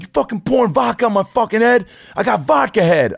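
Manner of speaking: high, loud hammed-up bouncer yell; mock furious bouncer. Bouncer yelling at the guy who spilled vodka on him, hammed-up and high. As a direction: blow up like a bouncer doused in vodka.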